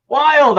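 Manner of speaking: Wild pitched high and stretched; mock grand emphasis. Host hamming up a viewer going wild, mock grand stress. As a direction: play up the word Wild like an old-timey gent. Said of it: "I say".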